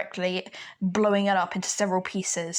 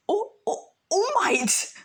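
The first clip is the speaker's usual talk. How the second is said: starstruck fan; 13 semitones higher, slower than usual. Acted. Fan meets his hero.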